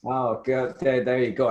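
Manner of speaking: usual speaking voice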